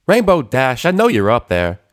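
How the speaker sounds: loud, high country call up to the cloud house; calling up with country accent. A narrator's reading. Applejack calling up to Rainbow's house, loud country call. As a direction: holler up at her like a farm pony. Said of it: "the voice called again".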